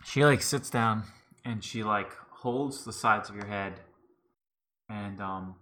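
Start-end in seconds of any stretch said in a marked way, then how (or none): none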